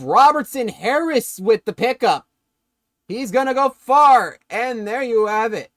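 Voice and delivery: live football commentary, building to a shout; excited play-by-play. Real, not acted. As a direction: burst into excitement as the play breaks. Calls a fumble live.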